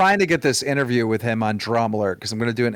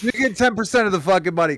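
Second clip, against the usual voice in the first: mock eager yes; 8 semitones higher, faster than usual. Humoring drunk friend Leafy about his ten percent, mock eager. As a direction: play along like you're humoring him.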